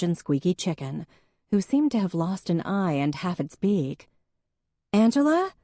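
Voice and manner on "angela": much higher, loud call through the house. A narrator's reading. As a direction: call out to find Angela. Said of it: "she called".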